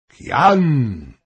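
loud, high call falling away; shouted summons from a king; king's shout. A narrator's reading. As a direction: call the name out sharply across the hall. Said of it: "krzyknął król".

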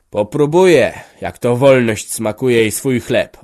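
loud, slow, bitter defiance; defiant answer. A narrator's reading. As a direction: spit out the words slowly and loud. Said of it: "odpowiadał stary". (stary odpowiada gromadzie, głośno i z goryczą)

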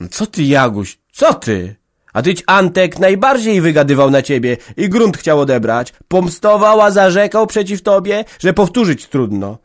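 put-on loud, high old mother warning; old mother rebuking. A narrator's reading. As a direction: scold her for taking his side. (matka ostrzega córkę)

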